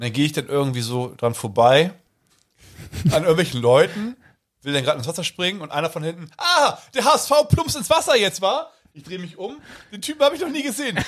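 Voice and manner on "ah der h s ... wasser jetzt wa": mocking stranger. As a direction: heckle him from behind. Stranger calls out at pool.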